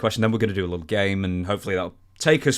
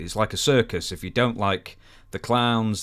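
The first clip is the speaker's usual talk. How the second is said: normal talking voice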